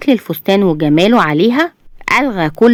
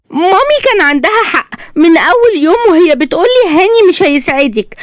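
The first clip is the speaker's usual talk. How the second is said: very high, whining complaint; aggrieved spoiled wife; 12 semitones higher than usual. A narrator's reading. Wife throws her mother's warning at husband, high and aggrieved. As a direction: lament in a high, reproachful wife's voice.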